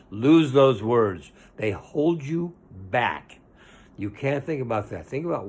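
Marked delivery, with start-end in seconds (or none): 0.1-3.4 slow, loud, emphatic order; slow forceful preacher command; firm command. Real, not acted. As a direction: drive each word home slowly.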